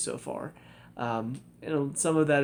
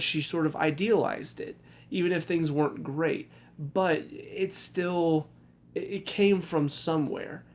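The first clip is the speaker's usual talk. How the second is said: ordinary talk, nothing marked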